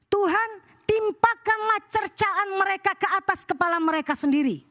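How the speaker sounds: high-pitched, impassioned pulpit voice; fervent preaching. Acted. Preacher urges church to hand insults to God, fervent preaching. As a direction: preach and pray it high, drawing out the calls to God.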